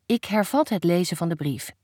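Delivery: plain reading voice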